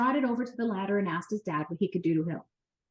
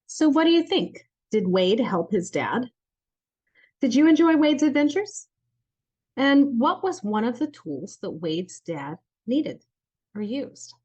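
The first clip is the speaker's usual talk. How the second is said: put-on reading to children; 3 semitones higher, 4 dB louder than usual. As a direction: ask young listeners with big enthusiasm. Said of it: "asks children questions after story".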